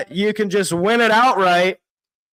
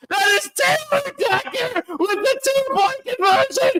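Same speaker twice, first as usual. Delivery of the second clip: high, screaming with joy, voice cracking; ecstatic shouting; 10 semitones higher than usual. Real, not acted. Fan celebrating the Lions going ahead, ecstatic screaming. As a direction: scream like you can't believe the miracle.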